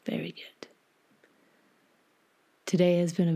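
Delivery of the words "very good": half-whispered, unhurried guidance; guided meditation. Acted.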